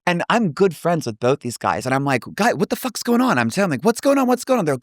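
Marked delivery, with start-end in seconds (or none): 2.3-4.6 high-pitched mock panic, urgent questioning; retold panicked confusion. Wrestler asking friends mid-beatdown what changed, retold panicked confusion. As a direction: urgently reenact not knowing what's happening.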